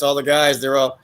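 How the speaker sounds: usual speaking voice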